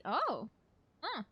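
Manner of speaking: ordinary talk, nothing marked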